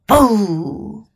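mild, drawn-out mock boo; a storybook scare without real shouting; restrained vampire boo; vampire's shout. A narrator's reading. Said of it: "有个吸血鬼跳出来大喊道".

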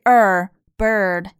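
"bird" with an American r-coloured er. Shown as a demonstration. Teacher shows American pronunciation, r-coloured er in "bird". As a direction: slowly sound the American er in "bird". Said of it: "bird美音发的是er".

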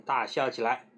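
plain reading voice

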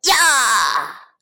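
tiger's sudden loud karate shout; roar. A narrator's reading. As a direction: let out a big roaring karate cry. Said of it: "大吼一声".

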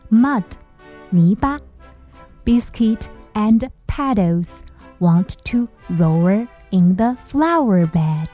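usual speaking voice

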